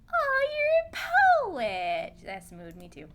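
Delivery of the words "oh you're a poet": high, loud, drawn-out coo; gushing exclamation. Real, not acted. Player discovering the character writes poetry, gushing exclamation. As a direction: gush with a big swooping "oh". Gushing reaction while playing.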